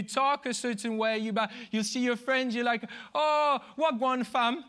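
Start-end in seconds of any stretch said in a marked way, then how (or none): 3.1-4.6 London slang greeting, shouted slow. Pretend. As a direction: hail a mate in London roadman slang. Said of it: "that was London slang".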